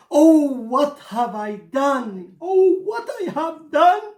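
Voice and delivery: rising wail of grief; despairing high wail; anguished cry. Acted. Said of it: "He let out a cry".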